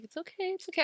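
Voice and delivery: soft, high cooing reassurance; high, hushed comforting; calming. Retold or imitated. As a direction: soothe a freaked-out friend gently. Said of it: "I was trying to calm her down".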